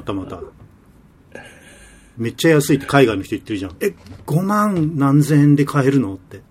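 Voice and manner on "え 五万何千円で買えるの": drawn-out, higher-pitched mock astonishment; imitated amazement. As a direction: quote amazed buyers, stretching the words. Voicing amazed overseas buyers.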